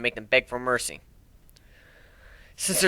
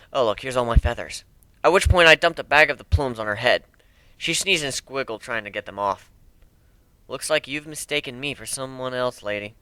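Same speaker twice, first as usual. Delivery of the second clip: ordinary delivery, nothing marked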